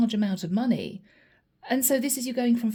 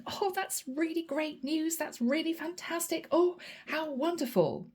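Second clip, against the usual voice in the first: higher, softer, gushing delight; delighted celebration; 5 semitones higher, 4 dB quieter than usual. Acted. Imagined Pisces celebrating good news, higher soft delight. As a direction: gush over great news. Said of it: "somebody bouncing around a living room".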